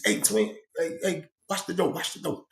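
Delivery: telling Twin to watch the door, fading to a hush; hushed order. Retold or imitated. Friend tells Twin keep watch.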